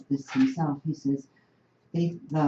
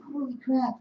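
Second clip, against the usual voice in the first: higher, stunned exclamation; amazed; 8 semitones higher, 4 dB quieter than usual. Retold or imitated. Marchers seeing the crowd part, stunned exclamation. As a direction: blurt it out in amazement. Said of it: "We're like".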